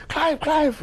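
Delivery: high excited calling of his name. Retold or imitated. Lady back from vacation runs to Clive, calling high and excited. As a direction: call out to him, thrilled.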